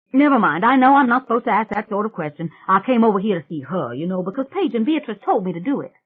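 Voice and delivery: put-on loud, fast, brash country girl; a loud, fast swamp girl rushing past her own question. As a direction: rattle it off loud like a cocky backwoods girl. [name]'s rowdy swamp girl voice.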